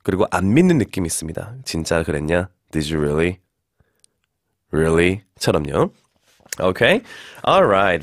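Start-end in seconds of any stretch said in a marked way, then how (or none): 1.7-5.2 low-pitched, sceptical "really"; sarcastic disbelief. Shown as a demonstration. Teacher showing how doubt sounds, low sarcastic disbelief. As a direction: drop "really" down low, not believing it. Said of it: "내려서 얘기하면은 조금 비꼬는 느낌".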